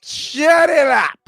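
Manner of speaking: high, loud, slow mock yell; stretched-out laughing shout of shut it up; playful yell. Real, not acted. Yelling at friend, laughing.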